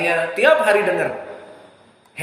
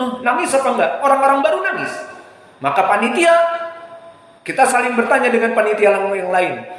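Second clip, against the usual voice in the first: ordinary talk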